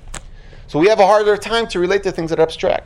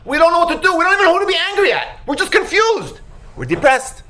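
high, agitated wail of bewilderment; acted helpless confusion; 10 semitones higher than usual. People unsure whom to be angry at, high-pitched exasperation. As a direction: protest helplessly in a raised pitch.

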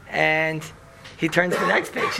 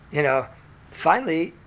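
ordinary talk, nothing marked

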